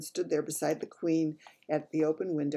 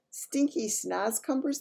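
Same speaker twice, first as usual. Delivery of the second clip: BFG voice; 7 semitones higher, slower than usual. Acted. BFG explains to the Queen.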